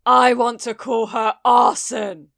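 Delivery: loud angry shout. Acted. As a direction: bellow it. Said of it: "Kacchan shouted".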